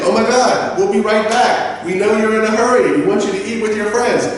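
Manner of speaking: high, hurried, helpful waiter; eager apologetic waiter. Acted. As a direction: play staff rushing to fix it. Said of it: "they should be like".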